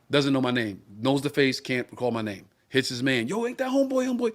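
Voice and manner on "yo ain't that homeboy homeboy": put-on drunk fan calling out. Drunk fan recognizing him.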